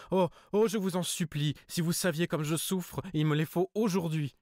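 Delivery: high, loud, desperate begging; tearful pleading. Acted. Hypnotized cousin begs for five thousand francs, tearful and loud. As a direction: plead through tears, voice cracking upward. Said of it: "Elle pleurait et bégayait".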